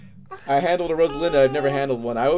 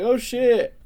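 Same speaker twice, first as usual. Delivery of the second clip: higher and louder with surprise; excited gasp; 12 semitones higher, 5 dB louder than usual. Retold or imitated. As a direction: blurt it out, thrilled. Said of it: "And I'm like".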